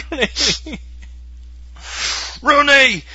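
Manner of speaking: very high, strained call; Ferris Bueller caller. Acted. Host imitating guy from Ferris Bueller, high call. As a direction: cry the name out high and long. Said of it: "the guy from, uh, Ferris Bueller".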